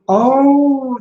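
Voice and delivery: ordinary talk, nothing marked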